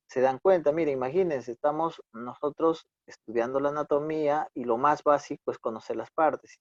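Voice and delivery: ordinary talk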